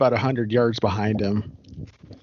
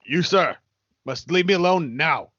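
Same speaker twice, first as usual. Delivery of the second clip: stern rebuff; 3 semitones higher than usual. Acted. Acted confrontation, Ancaster rebuffs pursuer.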